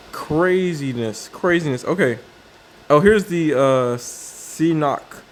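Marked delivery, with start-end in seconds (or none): none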